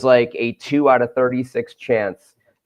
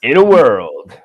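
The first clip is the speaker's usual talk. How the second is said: booming trailer narrator, louder; movie trailer voice; 5 dB louder, slower than usual. Shown as a demonstration. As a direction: announce it like an epic trailer. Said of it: "[name]'s trailer voice".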